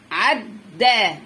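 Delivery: عدى split after a held doubled د; teacher slowly breaking عدى in two; split into syllables. Shown as a demonstration. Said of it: "في القراية وفي التحليل الصوتي".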